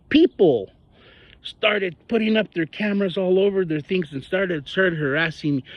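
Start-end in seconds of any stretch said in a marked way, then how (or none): none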